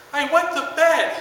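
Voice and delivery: put-on high, small kid voice; little voice. Uncle retelling the four-year-old's confession in a small high child voice. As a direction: say it like a sheepish toddler at the door. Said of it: "this little voice go".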